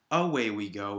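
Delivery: "away" stressed on the A, AY-way; stress on first syllable. Shown as a demonstration. Teacher showing what it's not, "away" stressed on A. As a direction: lean on the opening A of "away". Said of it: "It's not".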